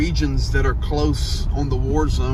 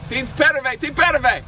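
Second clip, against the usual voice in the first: calling out; 11 semitones higher, slower than usual. Real, not acted. Calling out to team.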